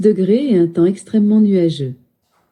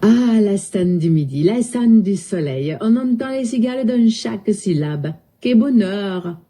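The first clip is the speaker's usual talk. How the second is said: singsong Midi accent, nasals opened up; southern French accent. Shown as a demonstration. Assistant showing off for a user, southern French accent. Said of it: "parle avec l'accent du sud".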